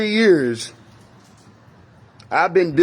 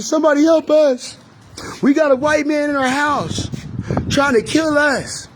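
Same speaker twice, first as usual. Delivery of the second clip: retold panicked scream; 10 semitones higher than usual. Calling to neighbors while a gunman is inside, high terrified screaming. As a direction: beg the neighbors for help as if your life hangs on it. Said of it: "I'm, I'm yelling".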